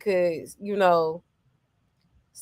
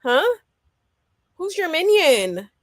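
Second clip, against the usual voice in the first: higher, mock-offended retort; playing the insulted friend, pitched up; 7 semitones higher than usual. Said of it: "I would have felt some type of way too. Like".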